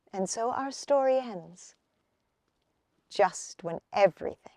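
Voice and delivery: usual speaking voice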